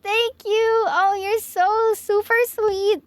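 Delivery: high, delighted thanks, drawn out slowly; excited squeal. Real, not acted. Streamer thanking Tulip for her support, squealing with delight. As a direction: gush your thanks to a friend, pitch way up.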